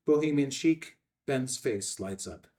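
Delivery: usual speaking voice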